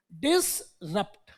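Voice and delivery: "डिसरप्ट" said very slowly and softly, drawn out; slow careful word. Shown as a demonstration. Teacher repeating the key word for the class, slowly. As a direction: drag out "डिसरप्ट" so they remember. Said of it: "ध्यान रखना".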